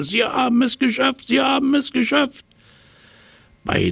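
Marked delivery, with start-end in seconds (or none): 0.0-2.5 very high, fast jubilant monkey; excited chattering. A narrator's reading. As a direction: cheer it out high and giddy. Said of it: "und schnatterte".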